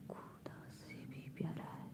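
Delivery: soft whispered echo of the line; whispered repeat of a poem line. Shown as a demonstration.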